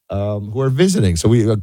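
normal talking voice

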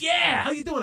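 high, friendly mock rocker greeting; a touch of rock star cheer. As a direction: greet a fan brightly. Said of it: "He says".

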